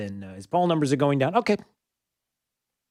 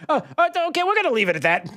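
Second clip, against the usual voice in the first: flustered TV host; 11 semitones higher, much faster than usual. Retold or imitated. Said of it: "It's like".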